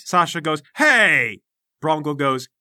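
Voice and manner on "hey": indignant hey. Acted.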